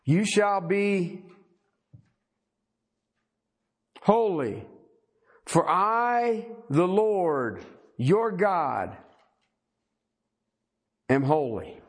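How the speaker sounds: solemn, measured reading with drawn-out phrases; scripture reading. Acted. Preacher reading God's command to the congregation, solemn scripture reading. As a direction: read out God's words in spaced, weighty phrases.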